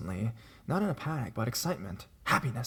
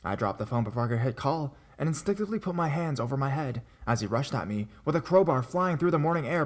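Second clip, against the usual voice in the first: ordinary delivery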